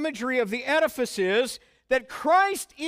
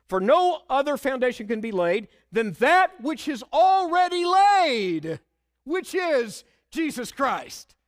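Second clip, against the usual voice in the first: louder, rising to "laid"; proclaimed scripture; 3 semitones higher, 4 dB louder than usual. Acted. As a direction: build up loud to "already laid". Preacher proclaims scripture verse.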